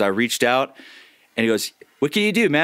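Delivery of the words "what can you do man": higher-pitched quoted impression; Zen. Voice actor retelling his recruiter's question, friend impression. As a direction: lift the voice to imitate a friend. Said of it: "It's a good Zen impression".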